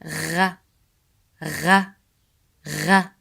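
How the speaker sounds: Gha with a throaty French-style R; Arabic ghain. Shown as a demonstration. Teacher drilling ghain like a French R. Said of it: "very close to the French R sound".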